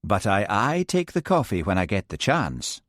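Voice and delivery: gentle Highland lilt; soft Highland voice. Acted. Hamish answering the Americans, soft Highland accent. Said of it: "he said in a soft Highland voice".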